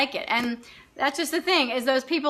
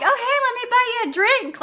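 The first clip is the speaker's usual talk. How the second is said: flirty waiter; 10 semitones higher than usual. Retold or imitated. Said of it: "the guy's like".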